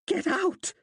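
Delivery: high furious screech. A narrator's reading. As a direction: screech it right into her face. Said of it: "he screeched into her face".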